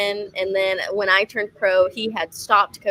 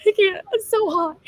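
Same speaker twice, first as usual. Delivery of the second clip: high, loud mock-crying whine; tearful complaint; 10 semitones higher, 5 dB louder than usual. Fighter crying to her coach in the hot gym, tearful. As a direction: imitate yourself wailing that you can't go on. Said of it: "I'm crying".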